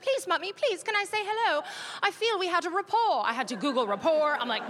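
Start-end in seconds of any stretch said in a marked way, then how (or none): 0.0-3.2 high-pitched begging daughter imitation; pleading child. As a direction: beg Mommy in a little girl's voice. Daughter begs to say hello.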